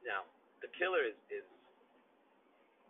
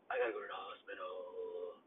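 shaken; 7 semitones lower, 7 dB quieter than usual. Real, not acted. Telling supervisor he's unwell.

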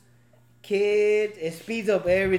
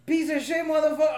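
mock kids cussing; 7 semitones higher than usual. Dad retells hearing his kids cuss during the movie, in a higher mock voice. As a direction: mimic a kid cursing another kid out. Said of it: "and then you hear".

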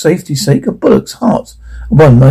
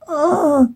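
soft, high-pitched groan; acted groan; 11 semitones higher, 7 dB quieter than usual. Narrator acts Ralph shot dead, groaning. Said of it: "Ralph groans".

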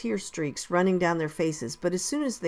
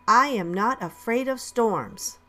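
higher, slow, deliberate declaiming; call-and-response recital; 5 semitones higher, 3 dB louder than usual. A narrator's reading. Teacher starting motto for lined-up children, declaimed. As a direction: lead a class chant with measured words.

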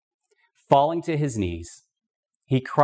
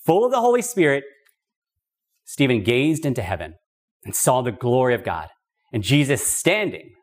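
ordinary talk

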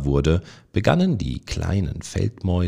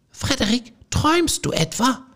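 much higher, reproachful squeaky voices; reproachful mice. A narrator's reading. The mice scold dreamy Frederik, high and reproachful. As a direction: scold him like fussy little mice. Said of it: "fragten sie vorwurfsvoll".

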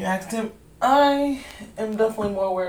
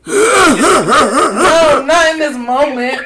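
loud laughing refusal; 7 semitones higher, 11 dB louder, much slower than usual. Real, not acted.

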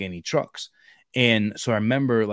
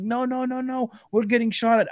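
much higher, frantic no no no; panicked protest; 13 semitones higher than usual. Retold or imitated. Afghan driver panicking to the soldier, high frantic pleading. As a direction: play the scared driver pleading mid-firefight.

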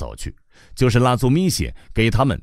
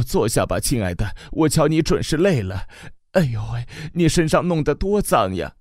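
更高的慈母声, 激动; 又哭又笑地念叨着; 絮叨的母亲; 6 semitones higher than usual. Acted. 母亲絮叨迎接儿子. (激动的母亲絮叨)

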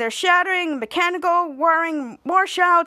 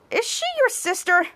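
higher question; 8 semitones higher than usual. Retold or imitated. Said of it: "Trent's like".

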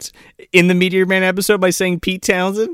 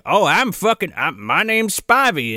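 hokey farmer voice; 4 semitones higher than usual. Retold or imitated. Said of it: "the lore bomb dropped of".

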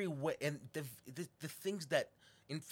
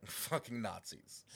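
slow, deliberate "fucking Nazis"; blunt emphasis; 3 semitones lower, 3 dB quieter, slower than usual. Real, not acted. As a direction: drag out "fucking Nazis" with scorn. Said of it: "be honest and call them what they are".

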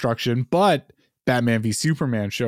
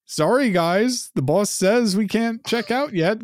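apologetic employee voice; 7 semitones higher than usual. Retold or imitated. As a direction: plead you can't leave yet.

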